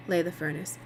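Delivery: plain reading voice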